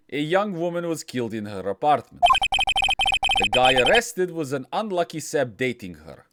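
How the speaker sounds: put-on lightly done Russian accent for Phoenix Wright; understated Russian accent lawyer narration. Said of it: "Japanese American guy is suddenly Russian".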